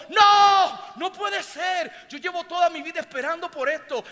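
high cry of amazement, loud on the first word; overwhelmed disbelief. Acted. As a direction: burst out high and astonished. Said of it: "Él se puso de pie y dijo". (incredulidad emocionada, a gritos)

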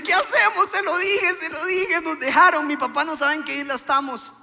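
high, loud panicked outburst; mock panic. Stranded wife blaming husband, hammed-up panic. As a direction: rattle off the worry in a high, frantic voice.

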